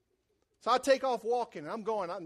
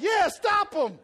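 calling out; 9 semitones higher, 8 dB louder, much slower than usual. Retold or imitated. Answering the helpful driver.

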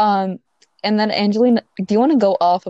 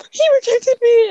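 much higher and louder, a hammed-up wail of disbelief; mock dramatic outcry; 5 dB louder than usual. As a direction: play it like a friend's over-the-top panic text. Friend's dramatic text being recounted.

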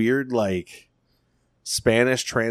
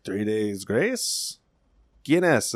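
very slow, drawn-out puzzled repeat; puzzled question; much slower than usual. Retold or imitated. Spanish-speaking streamer asked about a band, puzzled. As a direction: stretch out the band name, then ask in Spanish. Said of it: "And he's like".

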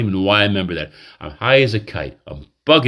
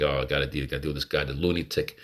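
mock serious professional; 7 semitones lower, 9 dB quieter, faster than usual. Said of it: "I'm real serious".

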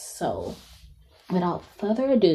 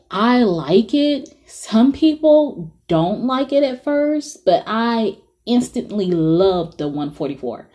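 normal talking voice

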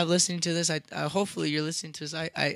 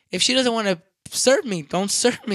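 louder, firm pushback; retold indignant retort; 4 semitones higher, 8 dB louder than usual. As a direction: lay it down emphatically, annoyed. Arguing back at the store.

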